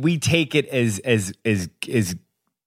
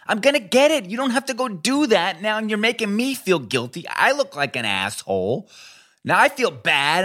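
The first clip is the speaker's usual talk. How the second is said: exasperated outburst; 11 semitones higher than usual. Retold or imitated. Husband snaps at wife over laundry, high exasperated outburst. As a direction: protest like you're the one being wronged. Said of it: "I'm like".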